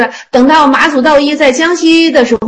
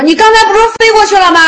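high, fast retort; sharp challenge; 10 semitones higher, 4 dB louder, faster than usual. Retold or imitated. Master challenges disciple over his hurting nose, sharp high challenge. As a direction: fire the question back, pitched right up.

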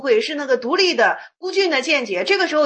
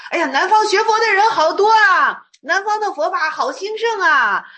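兴奋夸耀; 5 semitones higher than usual. Retold or imitated. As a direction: gush about the crowds in the south, pitch up. 僧人兴奋夸南方佛法. (兴奋地夸耀，声音高而响)

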